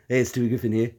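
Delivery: lightly voiced cartoon baby host; Stewie Griffin. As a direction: greet them as a genial little character, softening off. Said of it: "I guess, uh, Stewie Griffin".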